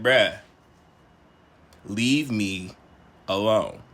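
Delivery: very low, halting retold warning. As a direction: leave heavy pauses between the words. Warns friend to back off.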